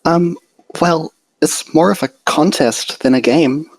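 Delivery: timid Fluttershy. A narrator's reading. Fluttershy shyly explains contest.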